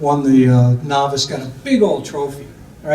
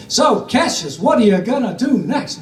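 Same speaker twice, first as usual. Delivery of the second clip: higher-pitched mock sportscaster; TV sports interviewer; 7 semitones higher than usual. Sports interviewer asking the champion about his next move, mock broadcast voice. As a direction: imitate a TV boxing interviewer putting a question. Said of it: "always interviewed on A B C Sports".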